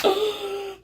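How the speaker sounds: high sharp gasp; awed gasp. Real, not acted. Vlogger amazed by Odin's line, high gasp. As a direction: gasp in awe. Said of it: "I was like".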